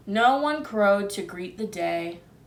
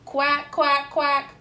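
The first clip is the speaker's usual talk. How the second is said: cow quacking; 4 semitones higher, 3 dB louder, slower than usual. A narrator's reading. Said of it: "Cow trotted up".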